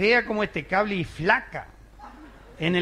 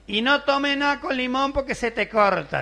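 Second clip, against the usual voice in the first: higher-pitched granny voice giving advice; warning grandma; 8 semitones higher, 3 dB louder than usual. Retold or imitated. Grandmother warning the sister, high granny voice. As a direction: scold like an old grandmother, pitched up.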